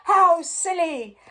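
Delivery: high, loud delighted shout; crowd shout. A narrator's reading. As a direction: shout with glee. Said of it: "shouted the crowd".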